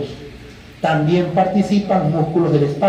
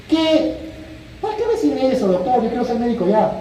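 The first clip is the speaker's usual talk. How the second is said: impatient student; 6 semitones higher, faster than usual. Retold or imitated. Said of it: "imagined student objecting".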